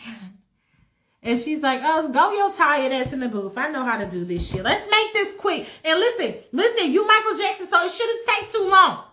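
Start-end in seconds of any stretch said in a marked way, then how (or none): 1.8-9.0 high, loud bossy orders; sassy woman taking charge; bossy diva. Acted. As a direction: order him into the booth. She bosses Michael into booth.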